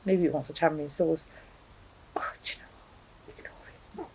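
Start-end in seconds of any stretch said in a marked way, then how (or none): 2.1-4.1 soft unvoiced whisper aside; breathy secret aside to the viewers. Real, not acted. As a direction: drop to a hushed whisper, as if not to disturb the cat. Hushed aside about the noisy cat.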